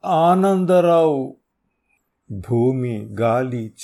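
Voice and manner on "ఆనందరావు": high, loud, slow naming. Acted. Terrified thug revealing the name, loud slow naming. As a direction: spell out the name loudly.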